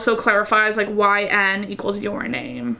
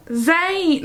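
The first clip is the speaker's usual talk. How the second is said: higher, falling British-accented name; 7 semitones higher than usual. Shown as a demonstration. Reader attempts [name]'s dialogue, bad British accent. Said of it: "try to do a British accent".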